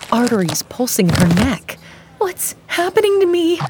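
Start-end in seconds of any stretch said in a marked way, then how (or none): 2.2-3.6 high, panicked plea; terrified. Acted. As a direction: beg in terror, voice way up. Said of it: "terror in her voice".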